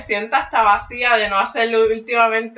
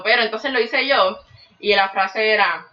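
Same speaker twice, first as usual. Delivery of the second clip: ordinary talk, nothing marked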